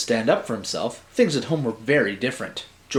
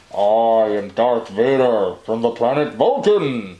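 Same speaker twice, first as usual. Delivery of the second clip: slow, louder, heavy Vader-style menace; Darth Vader voice; 4 dB louder than usual. Acted. Marty dressed as alien scaring George, slow mock Vader voice. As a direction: loom over him as a masked villain. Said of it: "Marty said".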